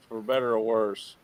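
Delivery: ordinary talk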